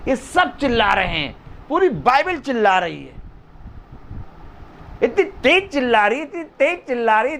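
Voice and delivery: high, driving repetition; impassioned preaching. Real, not acted. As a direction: hammer the refrain at a high, urgent pitch. Preacher builds on Bible crying out.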